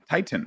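"Titan" with a crisp second T, tie-tin; hard T. Retold or imitated. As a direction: pronounce the middle T of "Titan" fully. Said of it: "like, hard pronounce the T".

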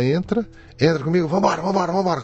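high, rapid urging; urgent captor. Retold or imitated. Hooded captor hustling the hostage along, urgent.

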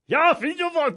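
high, loud mock German accent. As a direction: bark it in a hammed-up German accent. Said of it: "launched in some German accent".

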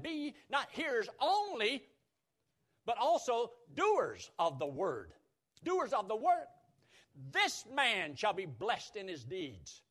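slow, high, forceful scripture quoting; emphatic preaching. Real, not acted. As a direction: drive each phrase home from the pulpit.

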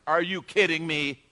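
retold exasperated outburst, slow and loud; indignant disbelief. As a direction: draw out an incredulous retort, mock outraged. Retells angry first reaction in traffic.

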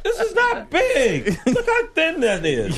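indignant protest. Real, not acted. Defending his big jacket.

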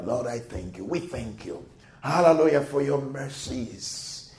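usual speaking voice

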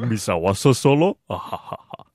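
alien boss line, trailing villain chuckle; Jabba the Hutt. Acted. Host repeating it for co-host, improved alien impression. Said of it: "do it better the second time".

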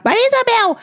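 high-pitched call across the room. Real, not acted. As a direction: shout her name up high. Calling out co-host's name. (chamada alta e aguda)

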